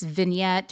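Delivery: vignette with the final T sounded; English pronunciation. Shown as a demonstration. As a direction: say vignette as vin-YET. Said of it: "no wrong way to say. It's vignette".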